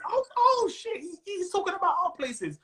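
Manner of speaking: very high-pitched amazed mock reaction; excited locals. Locals hearing their own places mentioned, excited. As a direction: squeal it like thrilled locals, imitating. Acting out locals' delighted recognition.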